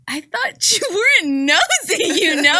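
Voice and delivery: high, laughing mock disbelief; re-enacted incredulous tease through laughter; amused disbelief. Amused thought about nosy neighbor.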